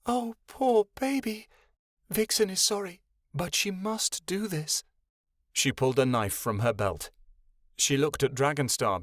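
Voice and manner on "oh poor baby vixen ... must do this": shaky voice. Acted. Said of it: "With a shaky voice".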